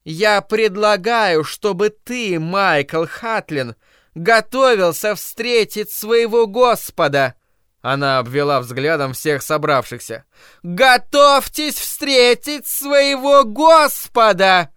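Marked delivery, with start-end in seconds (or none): 0.0-7.3 higher, louder, slow fire-and-brimstone threat; zealot's threat. Acted. 10.6-14.7 much higher, louder, slow doom proclamation; preaching shout. Acted. Zealot addresses whole crowd.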